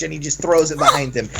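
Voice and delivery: usual speaking voice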